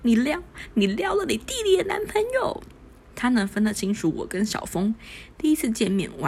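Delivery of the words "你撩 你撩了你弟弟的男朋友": shocked outburst. Acted. Shocked outburst after spit take.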